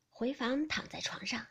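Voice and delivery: usual speaking voice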